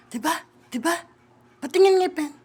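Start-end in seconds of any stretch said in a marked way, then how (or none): 1.6-2.3 higher, louder dentist commands; playful dentist role giving orders; bossy dentist. Pretend. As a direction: order like a pushy pretend dentist. Mock dentist orders child.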